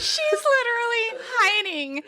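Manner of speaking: high, laugh-strained voice; helpless with laughter, pitched high; laughing incredulously. Real, not acted. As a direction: exclaim it through real laughter. Cracking up over absurd scene.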